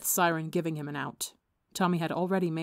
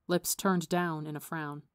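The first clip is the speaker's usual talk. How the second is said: ordinary delivery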